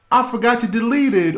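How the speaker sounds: high, fast, loud flustered excuse; husband caught by his wife, stammering a high, fast excuse; stammering liar. Retold or imitated. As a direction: stumble over the lie in a high panic. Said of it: "the multitude of lies to begin".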